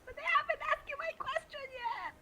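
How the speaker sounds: high-pitched plaintive wail; high, pleading complaint; wailed protest. Pretend. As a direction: protest in a high, whiny cry. Player protests character's sacrifice.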